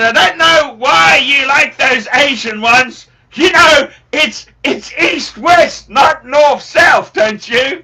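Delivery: slow, loud, high-pitched shouting father; dad shouting. Retold or imitated. Son imitates his old man ranting about his choice, slow shouting. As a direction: drag it out, yelling like an exasperated dad. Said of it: "He goes".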